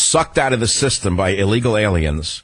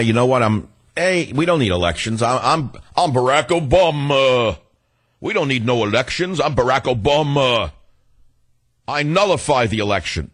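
higher-pitched mocking impersonation of a president; mock Obama boast; 5 semitones higher than usual. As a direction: imitate a leader grandly brushing the rules aside, stretching the name. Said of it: "Why can't he just say".

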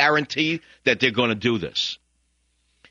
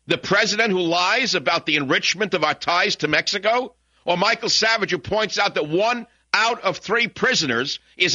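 pitched way up, pressing hard, indignant; heated rant; 10 semitones higher than usual. Real, not acted. Radio host ranting at his critics, heated and high. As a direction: fire back at the critics, rising high.

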